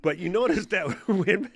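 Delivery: laughing too hard to talk; cracking up mid-sentence. Real, not acted. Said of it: "dude, I can't talk".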